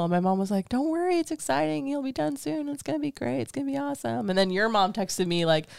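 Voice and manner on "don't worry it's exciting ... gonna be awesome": higher, soft mom voice, getting softer; a lightly voiced mom calming her down; imitating reassuring mom. Quoting her mom's texts to the listener, gentle mock mom.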